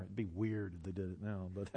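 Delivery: ordinary delivery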